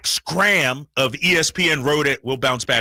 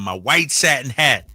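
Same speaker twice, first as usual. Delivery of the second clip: usual speaking voice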